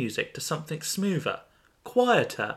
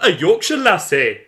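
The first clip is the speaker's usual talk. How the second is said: high, loud cheery "A Yorkshire lassie."; hearty greeting; 6 semitones higher, 10 dB louder than usual. Acted. The stranger greets Iris from Leeds, high and loud. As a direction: sing it out with delight. Acted story dialogue, greeting.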